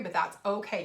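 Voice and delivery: normal talking voice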